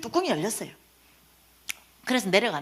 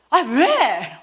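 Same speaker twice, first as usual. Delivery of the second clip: loud, raised-pitch retort; exasperated outburst; 6 semitones higher, 6 dB louder than usual. Retold or imitated. Speaker turning on the man who keeps calling, exasperated shout. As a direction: give up pretending and holler back. She finally snaps back at him.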